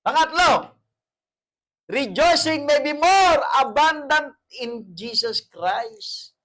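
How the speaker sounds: loud, high, slowed sermon cry; full-force preaching. Acted. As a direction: preach it out to the congregation.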